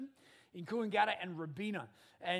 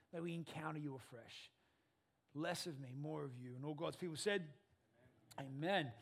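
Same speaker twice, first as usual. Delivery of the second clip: prayer; 2 semitones lower, 4 dB quieter than usual. Acted. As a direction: pray quietly and earnestly to God. Opening prayer.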